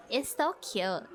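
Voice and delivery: ordinary talk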